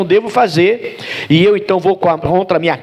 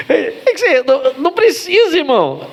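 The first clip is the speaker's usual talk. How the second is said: higher, talking through laughter; laugh-laced words; 10 semitones higher than usual. Real, not acted. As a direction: still chuckling as you speak.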